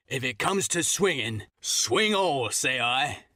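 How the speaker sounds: mild mock yell of a captain; defiant old seaman, understated; defiant captain shout. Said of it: "he cried once, and again".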